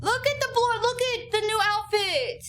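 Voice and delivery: high, squealing delight over a cute outfit; gushing excitement. Real, not acted. Gushing over character's new outfit.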